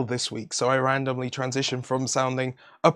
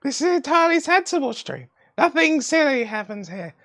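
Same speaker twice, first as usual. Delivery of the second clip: high squeaky voice; 11 semitones higher than usual. Pretend. Continuing the high silly voice.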